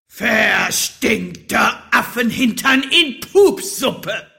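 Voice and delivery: slow, loud, high furious yell; roaring curse. Acted. Wizard roaring his favourite curse, furious bellow. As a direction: bellow it word by word. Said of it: "sein liebstes Schimpfwort brüllte er, so laut er konnte".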